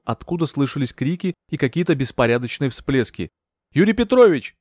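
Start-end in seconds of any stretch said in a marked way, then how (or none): 3.7-4.5 high, loud call across dark water; shouted name. A narrator's reading. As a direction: call out to find a missing man. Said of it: "кричала я".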